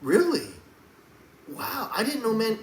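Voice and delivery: high, sarcastic disbelief; mock surprise. Scoffing at the woke roommate claim, in mock surprise.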